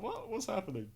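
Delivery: high, startled and bewildered; confused character. Retold or imitated. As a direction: sound lost and startled. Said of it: "meant to be a little bit like".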